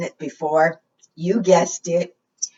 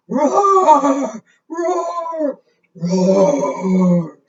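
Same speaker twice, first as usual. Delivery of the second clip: high, loud playful roars; playful storybook dragon roars for preschoolers; 8 semitones higher, 3 dB louder, much slower than usual. Shown as a demonstration. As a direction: roar for the kids, big and high. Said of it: "Can you roar for me?".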